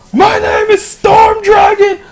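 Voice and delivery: huge, high-pitched drawn-out bellow; yelling dragon. Acted. Storm dragon announcing himself to the creature, yelling like mad. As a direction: roar out a dragon's grand introduction, stretching the words. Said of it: "dragon yelling like fucking mad".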